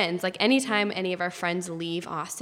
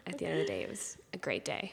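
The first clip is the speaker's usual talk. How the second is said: wry whisper; 3 semitones lower, 10 dB quieter, faster than usual. Real, not acted. Wry closing remark.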